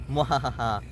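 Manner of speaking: drawn-out gloating cackle; hammy villain mwahaha; evil villain laugh. Acted.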